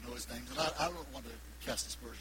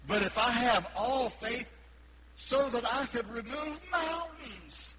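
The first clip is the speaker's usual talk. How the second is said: louder, higher, drawn out; slow building preaching; 9 semitones higher, 5 dB louder, slower than usual. Real, not acted. Preacher building on faith, slow and rising. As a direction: climb toward the peak slowly.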